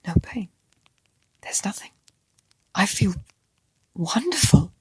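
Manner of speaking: hushed, wondering whisper; awed whisper. A narrator's reading. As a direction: whisper it in disbelief. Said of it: "as he whispered".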